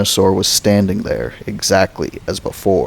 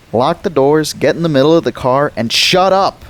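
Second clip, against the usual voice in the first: bossy order; 7 semitones higher than usual. Acted. Boy orders his sister to lock the doors, fast and urgent. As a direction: snap orders at your sister.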